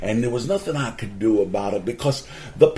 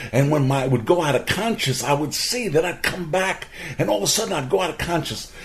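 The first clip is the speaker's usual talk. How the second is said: ordinary delivery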